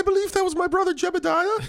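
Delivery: high falsetto lady voice. Pretend. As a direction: squeak it out like a prim bonnet lady.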